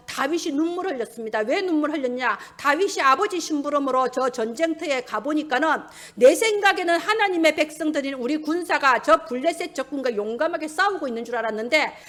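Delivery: usual speaking voice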